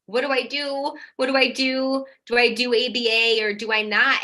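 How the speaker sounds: mock a panicky mum begging for answers; a hint of frantic asking; graspy energy. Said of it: "graspy energy".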